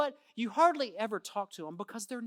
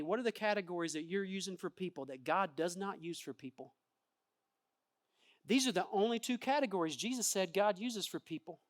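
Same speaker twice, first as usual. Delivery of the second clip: ordinary talk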